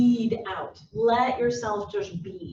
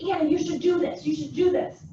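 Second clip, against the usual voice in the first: higher, eager push to a colleague; excited urging; 5 semitones higher than usual. Acted. Boss nudging Anna toward a new role, excited. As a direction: urge her on excitedly. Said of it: "you're all excited".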